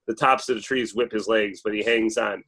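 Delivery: ordinary delivery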